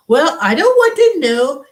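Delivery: mocking Indian accent, high and loud. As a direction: ham up an Indian call-center accent. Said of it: "they sound like that".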